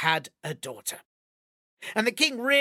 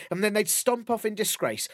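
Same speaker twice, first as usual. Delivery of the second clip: usual speaking voice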